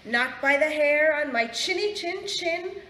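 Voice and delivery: loud, defiant storybook pig; loud chanting refusal; shouted. A narrator's reading. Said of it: "shouted the pig".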